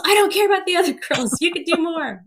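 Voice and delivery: high-pitched imitation of a coach overruling; insistent coach. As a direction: brush off the protest like a coach. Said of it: "I could hear him from across the gym".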